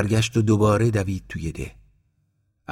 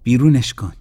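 much louder, higher insistent order; angry demand; 6 semitones higher, 8 dB louder than usual. Acted. Aunt ordering Abbas to throw the dog out, loud. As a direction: drive the dog out now.

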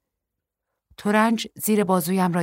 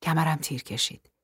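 usual speaking voice

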